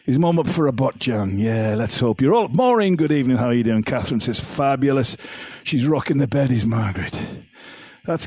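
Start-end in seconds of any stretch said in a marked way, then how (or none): none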